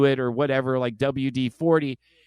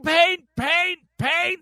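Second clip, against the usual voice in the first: high, loud repeated shout; shouted pain; 4 dB louder, much slower than usual. Retold or imitated. Boy in the pool answering the girls with a high shout. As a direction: yell it out while thrashing in pain.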